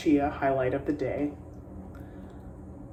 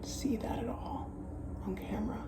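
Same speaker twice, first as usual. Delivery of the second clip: whisper; 6 semitones higher, 10 dB quieter than usual. Real, not acted. Soft aside while showing highlight.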